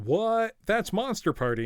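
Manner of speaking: high, loud disbelieving "What?"; mock the brother's stunned disbelief; incredulous. Brother texting back, stunned he beat it, incredulous. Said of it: "He seems incredulous".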